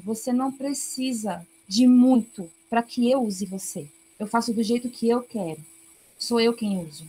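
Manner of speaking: ordinary talk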